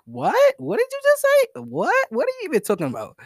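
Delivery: put-on high, fast confused girl voice. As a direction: squeak out her confusion like a baffled girl. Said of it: "she looks at you confused".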